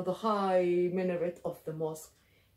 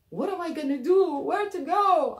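high, loud desperate questioning; frantic raised voice; panicked fugitive; 9 semitones higher, 7 dB louder than usual. Acted. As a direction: cry out with nowhere left to run. Narrator acts panic during the chase.

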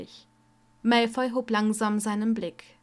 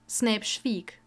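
plain reading voice

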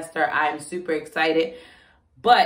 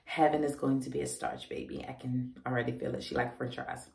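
ordinary talk, nothing marked

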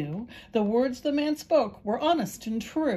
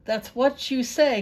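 louder, higher frightened cry; donkey protesting to the man in dread; 5 semitones higher, 6 dB louder than usual. A narrator's reading. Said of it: "cried the donkey with dread".

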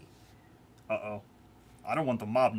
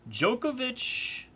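drawn-out high call; 12 semitones higher, much slower than usual. Acted.

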